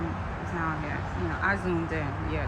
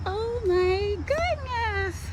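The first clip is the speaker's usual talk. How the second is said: drawn-out, high, breaking with wonder; slow, amazed cry at the view; awed gasp; 9 semitones higher, much slower than usual. Real, not acted. Awestruck at the view.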